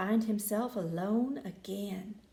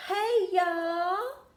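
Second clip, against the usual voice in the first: high, drawn-out friendly snake greeting; hissing snake; 7 semitones higher, 4 dB louder than usual. Acted. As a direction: call up to the birds like a shy snake. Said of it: "he hissed".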